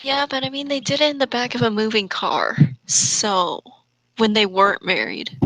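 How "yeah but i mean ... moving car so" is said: ordinary talk, nothing marked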